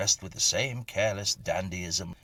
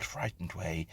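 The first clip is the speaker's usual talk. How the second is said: plain reading voice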